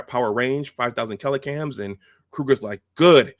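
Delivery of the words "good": loud, high punch on the word; gloating commander. Retold or imitated. Kroog hears the ship is closing, loud relish. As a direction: pounce on it loudly.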